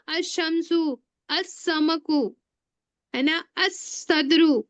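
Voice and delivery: अशमसु, असमकु, असदरु with the lam silent and the letter doubled; modeling sun-letter assimilation slowly; sun letter examples. Shown as a demonstration. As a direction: drop the l and double the sound. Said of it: "ये क्या दिख रहा है सबको?".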